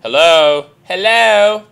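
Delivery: loud, drawn-out bad English hello; horrible English accent. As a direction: call out in a badly faked English accent. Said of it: "That was a horrible English accent".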